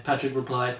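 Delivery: normal talking voice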